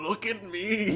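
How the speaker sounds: high, loud mocking mimicry through laughter. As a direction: jeer with drawn-out, laughing exaggeration. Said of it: "He mocks the chosen one".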